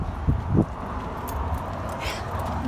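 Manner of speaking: long, breathy gasp of delight; excited gasp. Real, not acted. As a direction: gasp at the sight of your groomed dog. First sight of the groomed dog.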